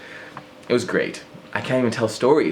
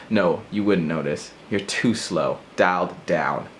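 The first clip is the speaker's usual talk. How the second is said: normal talking voice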